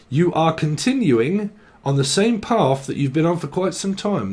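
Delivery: ordinary talk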